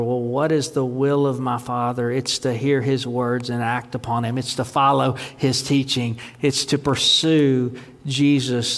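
ordinary delivery

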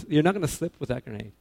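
normal talking voice

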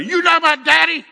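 high, loud mock toddler scream; child's scream. Grandson waking grandpa at night, high loud scream. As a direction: shriek it like a startled grandkid. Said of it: "And he screams at me".

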